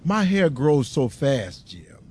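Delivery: normal talking voice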